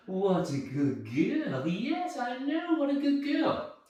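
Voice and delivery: soft, high cooing; sing-song, hushed delight; happy dog praise. Shown as a demonstration. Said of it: "a voice that's all happy and it's all excited".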